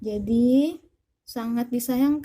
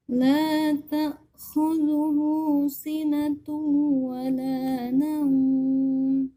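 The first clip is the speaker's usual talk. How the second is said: Quran recitation; 2 semitones higher than usual. Acted. Recites Ayat al-Kursi.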